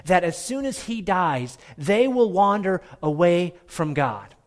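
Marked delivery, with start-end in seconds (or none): none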